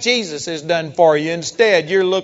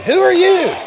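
higher, louder rhetorical challenge; challenging shout; 11 semitones higher, 6 dB louder than usual. Real, not acted. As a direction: throw the question at the crowd, loud and high. Preacher challenging the congregation.

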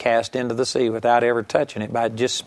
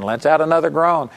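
ordinary delivery

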